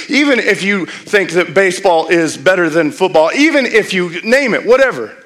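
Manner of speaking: rousing pulpit climax; fiery preaching. Acted. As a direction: preach it home with rising force. Preaching builds to full force.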